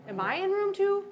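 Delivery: shocked to hear the box name her room; alarmed high question. Real, not acted. Investigator to partner after the box answer, startled and loud.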